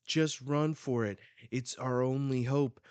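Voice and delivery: lightly acted desperate call; urgent fleeing. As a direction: urge them on as you flee. Voicing fleeing people in manga.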